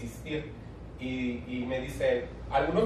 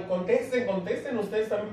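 urging quote; 6 semitones higher than usual. Retold or imitated. Others urging them to reply.

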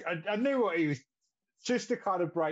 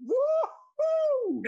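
a high, loud, put-on whoop; mock cheer; 5 dB louder than usual. Friend mocking the meet-the-dad moment with a high whoop.

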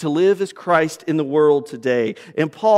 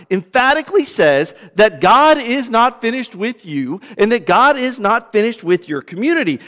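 ordinary delivery

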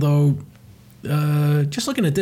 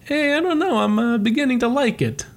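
high, sheepish Luigi voice; much higher playful voice; 11 semitones higher than usual. Acted. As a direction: squeak it out like a bashful Luigi. Luigi voiced liking the gown.

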